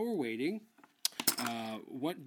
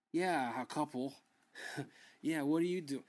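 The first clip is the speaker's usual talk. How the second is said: normal talking voice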